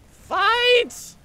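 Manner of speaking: high, loud shout; battle yell. Real, not acted. Player yelling at his army to fight, battle yell. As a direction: scream the order at the troops.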